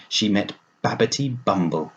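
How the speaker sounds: plain reading voice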